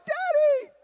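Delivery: mock high-pitched squeal for daddy; excited child's cry. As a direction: squeal it like a little kid. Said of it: "I was like".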